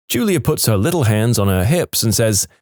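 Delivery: normal talking voice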